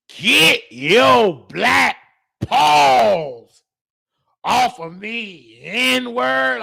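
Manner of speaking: put-on slow, commanding old patient's sneer; with authority. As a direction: hand down the insult slowly, like an order. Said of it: "she said it with authority".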